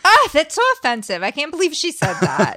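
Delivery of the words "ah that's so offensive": loud, high mock outrage; a playful burst of pretend indignation; mock offended. Mock offence at retold remark.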